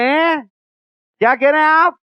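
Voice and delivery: high puzzled huh, just heard something; a startled elephant asking what was said. Retold or imitated. Elephant barely hears, asks. (चौंककर पूछता हाथी)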